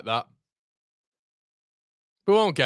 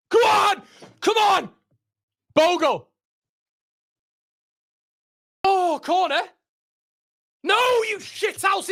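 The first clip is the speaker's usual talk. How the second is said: real shouting fan; 10 semitones higher than usual. Real, not acted. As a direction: yell at the team to push. Fan urges on an attack.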